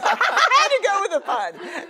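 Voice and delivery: laughing through the words, pitch shooting up; giggly confession to a bad pun. Real, not acted. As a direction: crack up at your own pun. Laughing at her own pun.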